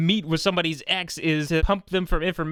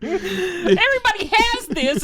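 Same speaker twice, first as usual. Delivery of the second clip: laughing; 11 semitones higher, slower than usual. Real, not acted. Laughing while retelling scene.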